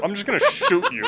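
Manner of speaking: giggling through the threat; laughing. Real, not acted. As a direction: laugh it out, quick and light.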